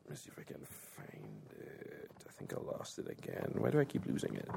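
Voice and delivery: barely voiced mutter, far off the mic; muttering to himself. Real, not acted. Reader searching for his lost page, muttering under his breath. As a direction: mumble it to yourself while hunting for the page.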